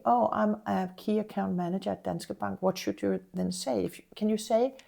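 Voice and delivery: normal talking voice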